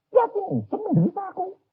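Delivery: high, strained, whiny complaint; suffering woman in labour. Retold or imitated. Woman in labour complaining to her husband, high and whiny. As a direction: moan like a mother in labour blaming her husband.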